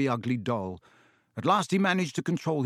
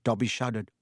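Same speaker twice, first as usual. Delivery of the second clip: usual speaking voice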